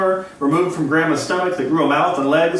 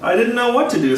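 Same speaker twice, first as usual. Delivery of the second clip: usual speaking voice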